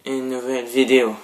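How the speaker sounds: nouvelle vidéo said loud and tipsy, vidéo stressed; loud tipsy retake; loud restart. Shown as a demonstration. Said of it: "j'ai un peu trop bu".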